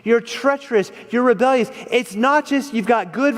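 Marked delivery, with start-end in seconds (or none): 0.0-1.7 mock accusing Saul. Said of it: "and Saul says".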